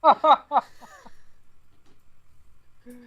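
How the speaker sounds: high-pitched burst of laughter; hearty laugh. Real, not acted. Hearty laugh at show's opening.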